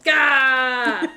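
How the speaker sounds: loud, drawn-out "gah!"; big acted-out exclamation; mock outburst "gah". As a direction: burst out with an overwhelmed yell. Acts out excited reaction to scene.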